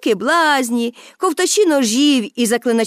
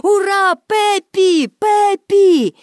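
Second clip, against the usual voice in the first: crowd cheering; 8 semitones higher, slower than usual. A narrator's reading. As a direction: chant her name like the audience. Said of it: "і кричати". (публіка скандує ім'я Пепі)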